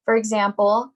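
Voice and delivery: ordinary talk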